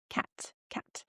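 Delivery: whispered soft cat. Shown as a demonstration. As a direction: breathe the words like a secret.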